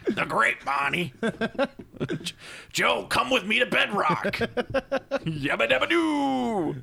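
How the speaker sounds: high, slow cartoon caveman voice; hammed-up high cartoon Flintstones voice; Fred Flintstone impression.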